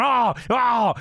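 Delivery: J R. Retold or imitated. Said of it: "J R's going".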